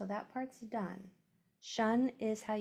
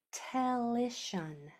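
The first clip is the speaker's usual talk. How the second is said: slow stretched word; much slower than usual. Shown as a demonstration. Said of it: "Our word is a".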